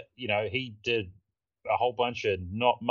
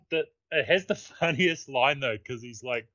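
ordinary delivery

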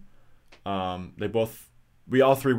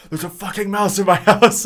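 higher and louder, half laughing in alarm; panicked retelling; 9 semitones higher, 8 dB louder than usual. Retold or imitated. Calling her sister, panicked and laughing about the mouse. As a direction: squeal it out like the mouse is right there.

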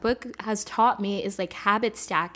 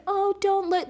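much higher, shy mock protest; mock modest squeak; 10 semitones higher than usual. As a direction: play falsely modest, pitched way up. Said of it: "very, like, modest".

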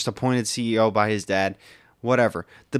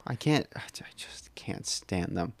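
hushed unvoiced whisper; 7 semitones lower, 14 dB quieter than usual. Real, not acted. Confessing she can't stand them, in a hushed whisper. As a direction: whisper it like a secret. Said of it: "whispered confession".

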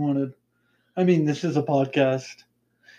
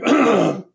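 loud rough throat clear; 5 semitones higher, 5 dB louder than usual. Real, not acted. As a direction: clear a sore throat hard. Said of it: "My throat is kicking my ass today".

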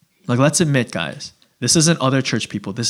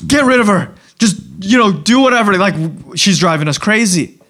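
exasperated disciples; 5 semitones higher than usual. Retold or imitated. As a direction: beg Jesus to get rid of her, exasperated. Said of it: "they begged him".

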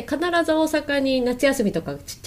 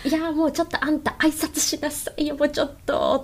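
higher, scolding Osaka mother voice; Osaka mom; 4 semitones higher, 3 dB quieter than usual. Retold or imitated. Host imitating a mother at the supermarket, nagging Osaka-mom voice. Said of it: "大阪のおかんってあるじゃないですか".

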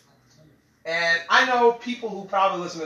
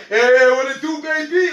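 cocky driver greeting; 8 semitones higher, 7 dB louder than usual. Retold or imitated. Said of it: "He's like".

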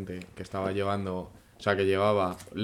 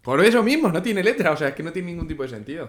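loud, high and worked up; heated exclaiming; 7 semitones higher, 6 dB louder than usual. Real, not acted. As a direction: exclaim it in disbelief. Arguing heatedly about anthem.

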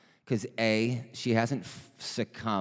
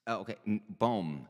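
normal talking voice

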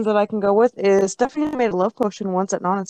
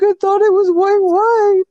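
innocent excuse; 12 semitones higher, 6 dB louder, slower than usual. Retold or imitated. Innocent excuse to angry sister.

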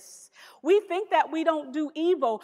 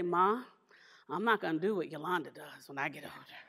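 low, soft mock-innocent tattling voice; nice calm voice, put on; imitating calm younger brother; 9 semitones lower, 5 dB quieter than usual. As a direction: mimic your little brother calmly telling Ma, low and soft. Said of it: "he would say in a nice, calm voice".

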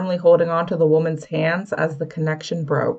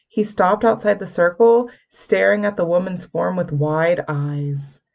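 ordinary delivery

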